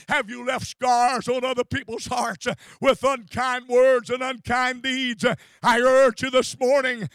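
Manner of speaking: loud, shouted altar call; full-force preaching. Acted. Preacher urging the church to repent, shouting loudly. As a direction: pound the words at the congregation.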